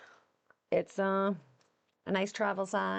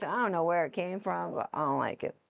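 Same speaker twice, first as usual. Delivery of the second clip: normal talking voice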